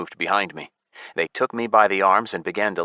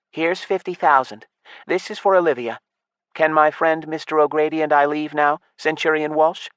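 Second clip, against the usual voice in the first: put-on higher young woman's voice; elf girl; 9 semitones higher than usual. A narrator's reading. Elf girl paying Walsh to free Patrick, higher voice. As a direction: pay him off, composed.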